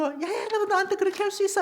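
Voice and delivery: high-pitched mock indignation; indignant complaint. Offended child confronts a friend, indignant complaint.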